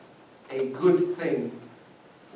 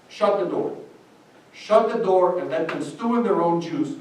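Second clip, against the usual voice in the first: ordinary talk, nothing marked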